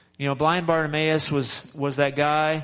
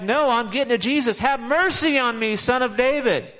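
higher, insistent call for mercy; determined cry; 7 semitones higher than usual. Retold or imitated. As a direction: push past them, crying out for Jesus. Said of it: "He's like".

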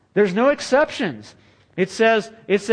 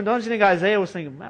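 normal talking voice

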